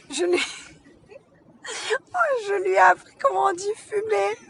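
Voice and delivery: words breaking up into laughter; laughing so hard she can barely talk; crying with laughter. Real, not acted. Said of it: "je pleure de rire".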